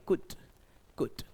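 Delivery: could said as kood, no l; repeat after me. Shown as a demonstration. Teacher leads the class in repeating could as kood. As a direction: model could slowly, l silent. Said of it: "मेरे साथ दोहराइए".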